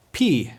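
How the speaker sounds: "P" as "pee", ee vowel; Greek pi. Shown as a demonstration. Teacher names the Greek letter, pronounced pee. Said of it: "it's P".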